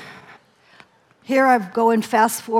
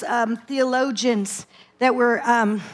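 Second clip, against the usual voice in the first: ordinary talk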